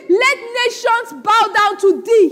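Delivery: loud, slow, high declaration of "Let nations bow down to thee"; emphatic conviction. Acted. Preacher voicing Isaac's blessing as truly meant, emphatic. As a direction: proclaim "bow down to thee" loudly and slowly, meaning it. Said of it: "he meant it".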